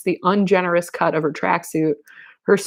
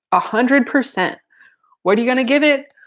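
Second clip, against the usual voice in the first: higher, lively call for a response; rallying call; 7 semitones higher than usual. A narrator's reading. Matthew asking the whole room, rallying call. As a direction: pump up a crowd.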